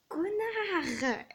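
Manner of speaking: high, drawn-out exasperated insult; real, high-pitched flare of annoyance; annoyed outburst. Real, not acted. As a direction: let the irritation burst out on one word. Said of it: "je suis tellement énervée".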